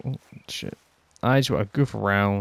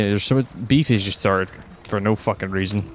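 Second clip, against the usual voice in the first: usual speaking voice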